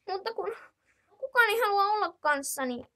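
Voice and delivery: put-on high, young child's voice calling auntie; little child asking auntie. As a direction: play a small whiny kid.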